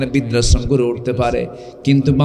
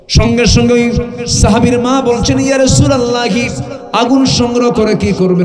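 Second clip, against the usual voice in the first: high, loud urgent storytelling; full-force preaching; 11 semitones higher, 5 dB louder than usual. Retold or imitated. Preacher retelling a mother questioning the Prophet, full-force preaching. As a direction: ring out the mother's startled question.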